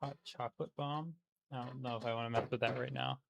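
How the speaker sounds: soft muttering to himself in a German-free plain voice; soft, quick self-talk; quiet aside. Real, not acted. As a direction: mumble it under your breath, half to yourself.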